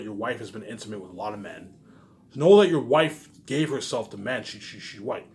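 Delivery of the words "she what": usual speaking voice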